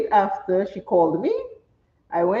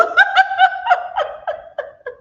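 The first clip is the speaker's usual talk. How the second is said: long high laugh falling away; high laugh falling off at husband's teasing line; 3 dB louder than usual. Real, not acted. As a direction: laugh hard at the husband's tease.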